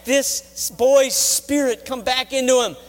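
fervent prayer cried aloud; praying out loud. Acted. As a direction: beg the Lord, leaning hard on each word. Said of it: "praying out loud".

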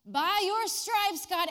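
hammed-up pray-er; mock insistent. Preacher mocking quoting scripture at God.

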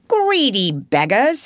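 lightly voiced otter, drawn-out and loud; teasing otter. A narrator's reading. Otter teases the feasting friends.

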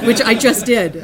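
loud, real laughing admission; laughing through the words. Real, not acted. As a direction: break up laughing at your own slip. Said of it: "I'm just laughing because I had".

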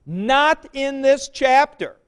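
not in THIS chapter, slow, high and loud; stress on THIS. Shown as a demonstration. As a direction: hammer the word this home. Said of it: "I want to emphasize this point".

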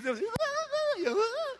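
very high wordless squeal; imitated fan scream. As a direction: imitate mom shrieking for her idol. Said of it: "Yeah, she's".

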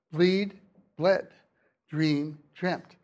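spaced word pairs, ee in "Bleed" and "Dream", eh in "bled" and "dreamt"; long-short vowel contrast. Shown as a demonstration. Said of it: "It's a systematic change".

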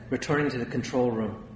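ordinary delivery, nothing marked

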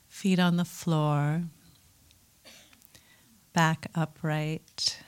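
calm meditation guide with long pauses; guided meditation. Acted. Teacher settling a group into mindfulness practice, calm guiding voice. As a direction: settle listeners in gently, leaving space between phrases.

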